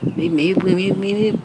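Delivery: loud pleading maybes; urgent stacked maybes; insistent repeated maybe. Real, not acted. Emphatic repeated maybe to viewer.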